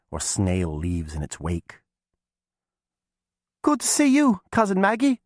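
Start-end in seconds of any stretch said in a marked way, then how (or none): 3.6-5.2 high cheerful call. A narrator's reading. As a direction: call out brightly to a cousin. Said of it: "Alice called out in a cheerful voice".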